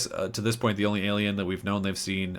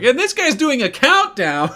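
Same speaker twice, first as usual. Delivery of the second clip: much higher, loud indignant shout; exasperated yell; 10 dB louder than usual. Retold or imitated. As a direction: yell in disbelief at the countdown. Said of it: "Bailey starts freaking out".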